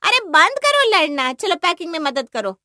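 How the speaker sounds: high, loud scolding; exasperated shout. Acted. Mother breaking up children's fight while packing, shouted scolding. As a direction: yell at them to stop, then ease slightly.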